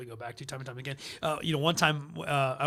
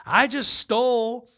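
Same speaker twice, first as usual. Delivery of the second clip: loud, raised, hammed-up drunk confession; boisterous impression of a drunken intruder; imitating drunken heckler; 7 semitones higher, 7 dB louder than usual. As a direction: play the drunk announcing himself to the whole room. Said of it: "this drunken guy walks into".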